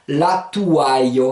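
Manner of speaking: "la touaille" slow, as la tou-ai-o; slow demonstration. Shown as a demonstration. Teacher assembles five vowels into a word, slow "la touaille". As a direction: draw out each vowel group in "la touaille". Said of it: "Rapidement, ça se dit".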